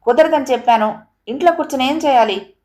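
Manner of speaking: irritated wife snapping back. A narrator's reading. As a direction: brush him off, fed up. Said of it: "విసుగ్గా అడుగుతున్న".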